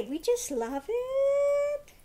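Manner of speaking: high slow happy dog speaking through its owner; excited dog voice. Retold or imitated. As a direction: gush like a delighted dog. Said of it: "They say".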